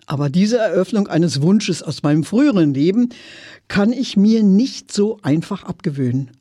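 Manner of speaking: ordinary delivery, nothing marked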